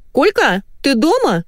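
high-pitched squeal of a wife; squeally wife. A narrator's reading. Wife calling her husband on speakerphone, squeally. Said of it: "Полетел по закусочной визгливый голос".

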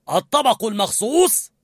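high, impatient demand; angry. A narrator's reading. Sous-chef out of patience, angry question. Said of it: "وسأل بغضب".

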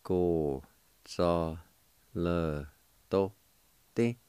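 slow, separated syllables Go, za, le, do, di. Shown as a demonstration. Teacher sounding out the word syllable by syllable. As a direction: give each syllable of Go, za, le, do, di its own beat. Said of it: "it's pronounced like this".

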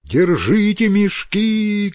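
high, drawn-out call; sailors shouting. A narrator's reading. Sailors shout to crewmates as cargo washes away, crying out. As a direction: call out slowly over a storm. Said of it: "кричат одни".

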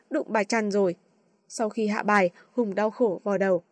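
ordinary delivery, nothing marked

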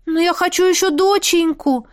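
high capricious pouting; little princess. Acted. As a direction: pout it like a spoiled girl. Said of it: "капризно надула губки".

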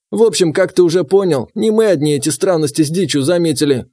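put-on gruff old gamekeeper; old man voice.

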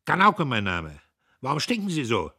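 high, loud, curt officer; loud high lieutenant voice; clipped officer voice. A narrator's reading. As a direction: bark it like a brash lieutenant. Premierleutnant voice.

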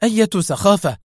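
high, indignant retort; sharp, piqued question; heated. A narrator's reading. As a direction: snap back, offended. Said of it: "هتفت محتدة".

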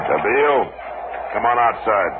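calling out. Acted. Marshal calls man out of shack.

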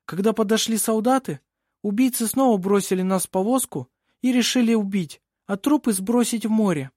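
put-on high-pitched young woman testifying; earnest girl witness. A narrator's reading. Винга tells the court of attempted murder, earnest. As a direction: insist on every detail of the attack.

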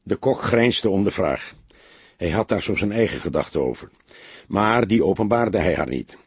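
ordinary delivery, nothing marked

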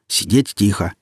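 plain reading voice

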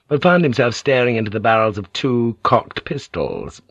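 usual speaking voice

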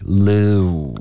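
"lu" drawn out, rounding only at the end; relaxed-to-pursed "lu". Shown as a demonstration. Teacher corrects half-pursed lips, slow gliding "lu". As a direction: start "lu" loose, then pout. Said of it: "开头是放松，后面嘟起来".

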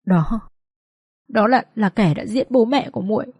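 halting, mildly quavering answer; trembling voice. Acted. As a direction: falter fearfully between words. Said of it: "My run run giọng và trả lời".

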